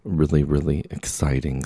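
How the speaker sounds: usual speaking voice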